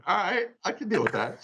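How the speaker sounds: high, amused laughing reply; high playful laughing acceptance; laughing quote. Retold or imitated. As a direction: go along with it, laughing. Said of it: "I was like".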